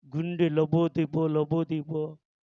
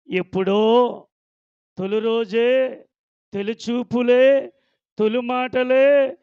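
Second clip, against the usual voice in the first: higher, louder building declaration; passionate vow; 4 semitones higher, 5 dB louder than usual. Retold or imitated. Boy swearing love to the girl, higher and louder.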